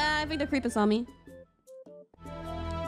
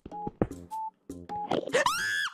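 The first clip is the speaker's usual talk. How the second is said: startled gasp; 4 dB quieter than usual. Real, not acted. Startled by creeper.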